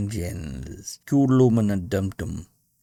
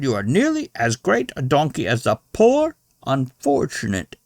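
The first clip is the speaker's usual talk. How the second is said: put-on higher, louder offended old lady scolding; indignant old lady; 6 semitones higher, 7 dB louder than usual. A narrator's reading. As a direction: scold him like a prim old dame. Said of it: "interrupted an old lady".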